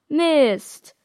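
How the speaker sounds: angry shout of "Mist" as the phone dies; frustrated curse. Acted.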